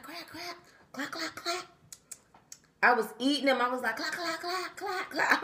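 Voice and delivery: crab-cracking noises. Retold or imitated. Imitating cracking crabs while eating.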